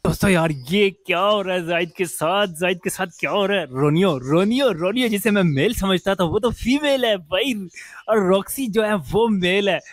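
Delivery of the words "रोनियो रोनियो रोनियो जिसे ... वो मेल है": surprised. Real, not acted. Shocked discovery of pet's sex.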